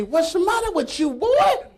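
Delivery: high, loud, laughing and drawn out; the coach cracks up at the boy's fear. Retold or imitated. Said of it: "Coach comes up to me".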